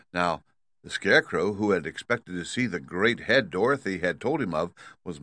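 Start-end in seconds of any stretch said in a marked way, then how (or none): none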